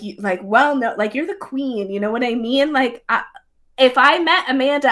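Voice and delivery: ordinary talk, nothing marked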